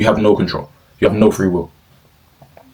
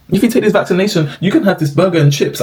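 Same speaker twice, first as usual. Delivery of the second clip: higher, sing-song mock of a vaccine promo; 9 semitones higher than usual. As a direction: parody the cheesy promo pitch, pitched up.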